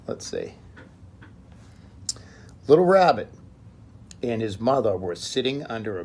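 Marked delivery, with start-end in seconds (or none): none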